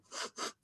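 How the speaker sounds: one short audible sniff through the nose; imitated sniff. Teacher sniffing at the new girl, a quick sniff. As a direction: sniff once as if checking a smell. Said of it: "sniffs you".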